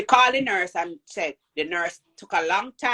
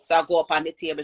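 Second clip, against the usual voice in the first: ordinary talk, nothing marked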